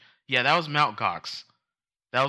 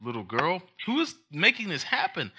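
high, laughing disbelief; can't believe what you're hearing; higher, cracking with laughter; incredulous; 8 semitones higher, 8 dB louder than usual. Retold or imitated. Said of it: "And I'm like".

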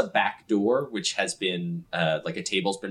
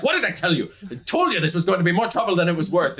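high, louder, fast telling-off; scolding; 10 semitones higher, 4 dB louder, faster than usual. Acted. Sky scolding Bido after pushing him off the table. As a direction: scold the little troublemaker.

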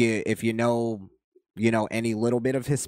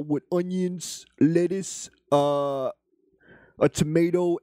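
higher put-on ordering voice, Spanish accent on jalapenos; imitated friend ordering; 4 semitones higher than usual. Imitating a friend ordering food, throws sauce on jalapenos.

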